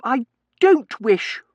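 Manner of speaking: high, loud, slow stamp on each word; foot-stamping insistence, pitched high; petulant tantrum. A narrator's reading. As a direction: refuse like a spoilt miss. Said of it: "stamping her little foot".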